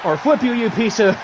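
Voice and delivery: normal talking voice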